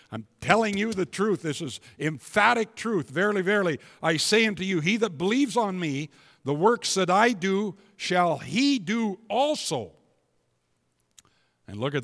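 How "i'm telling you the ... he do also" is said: full-force preaching. Acted.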